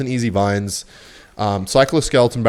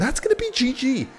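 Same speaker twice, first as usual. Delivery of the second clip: high, excited hype; 13 semitones higher than usual. Real, not acted. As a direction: voice jumps up with glee. Hyped at winning play.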